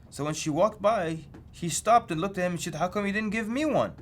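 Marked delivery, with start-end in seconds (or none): 2.7-3.9 higher-pitched, put-out question; indignant question. Retold or imitated. Lady passed over asks the pamphlet man, indignant. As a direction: ask it high, as someone left out.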